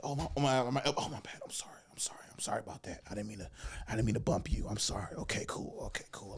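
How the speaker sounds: whispered, sheepish repeated apologies; hushed apology. Retold or imitated. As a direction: murmur sorry under your breath to a seatmate. Acts out apologising to seatmate after bump.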